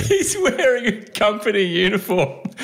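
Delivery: high, laughing incredulity. Real, not acted. Host reacting to the courier's sacking, laughing. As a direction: laugh your way through it.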